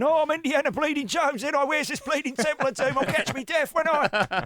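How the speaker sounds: put-on high cockney old-codger voice; elderly action hero done as a squawky cockney; Jim from East End. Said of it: "he's looking very Jim from East End".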